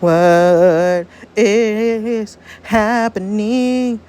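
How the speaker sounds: every word stretched extremely long; very slow, sustained wail; drawn-out distress. Acted. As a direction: drag each vowel out as time warps. Time travel effect, words stretched out.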